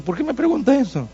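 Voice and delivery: imitated waiter, pitch jumping up in surprise; mock-baffled high question; puzzled waiter. Voicing the puzzled young waiter's question.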